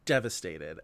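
slow, low, dropping to a whisper; mock devastated. Leaving the cinema pitying the character, mock-devastated hush. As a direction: mourn it half-jokingly. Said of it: "I was just like".

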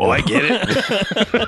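slow, high mock 'oh, I get it'; mock realization. Reacting to a friend's seven-layer joke, mock realization. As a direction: drag it out high, laughing.

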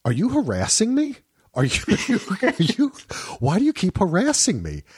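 imitating an offended wife, high and breaking into laughter; mock outraged wife. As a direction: ham up her indignant accusation. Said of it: "And she'd be like".

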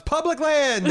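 high, loud mock holler; imitated shout. Host quoting the killer kicking him off, imitated shout. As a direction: holler it out as the character. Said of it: "and he shouts".

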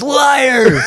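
high shouted Liar!; shouted accusation. Retold or imitated. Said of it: "he goes".

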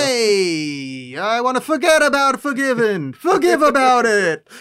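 loud, high Italian mobster voice; hammed-up Italian gangster; Italian accent. Continuing the Italian mobster bit.

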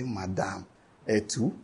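very low, soft onlooker's aside; hushed mock disbelief. Onlookers question an older pregnant woman, hushed mock disbelief. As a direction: drop right down, quietly questioning.

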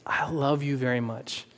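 soft gentle honey; gentle loving. Retold or imitated. Husband gently reassuring his wife, soft and tender. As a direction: speak it softly, tender. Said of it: "the Spirit led me to, to just be like".

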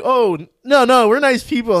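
loud, high-pitched protest; hasty mock reassurance. Armed friends reassuring screaming hikers, loud hurried protest. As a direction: call out to calm them, loudly.

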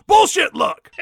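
loud, high mock yell; mock scream. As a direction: shout it like a racer. Rowdy screams out.

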